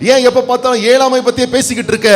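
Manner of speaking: fast, high rebuke of a sulking soul; scolding his own soul. Acted. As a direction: chide yourself quickly and sharply. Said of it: "சங்கீதக்காரன் சொல்லுகிறான்".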